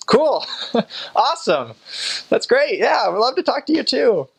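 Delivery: very high, bubbly, laughing enthusiasm; overeager missionary. Retold or imitated. Missionaries to the stranger, very high bubbly enthusiasm. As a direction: gush with delight.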